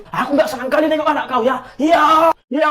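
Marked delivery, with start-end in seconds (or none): 1.8-2.7 shout. Acted. Kid shouts agreement in quarrel.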